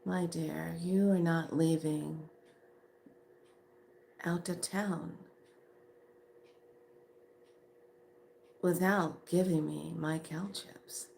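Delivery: soft, measured warning with long pauses; very calm. Retold or imitated. Woman warning her friend over kale chips, very calm. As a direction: deliver the ultimatum very calmly, hushed. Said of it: "I just said very calmly".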